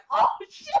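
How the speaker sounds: high, loud laughing yelp; hammed-up shock through laughter; startled. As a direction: blurt it in mock alarm. Frog suddenly moves.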